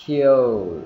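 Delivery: KILL drawn out with tongue rising into L; loud model KILL with short I and gradual L; short I, dark L. Shown as a demonstration. Teacher demonstrating for students, short I and dark L in KILL. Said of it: "short I và đưa cái đầu lưỡi từ từ lên".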